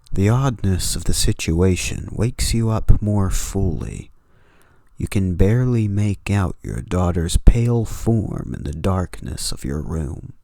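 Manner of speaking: steady horror storytelling read; story narration. A narrator's reading. Narrator setting a late-night horror scene, measured story read. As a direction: draw the listener into the dark room.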